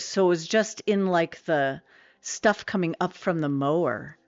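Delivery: usual speaking voice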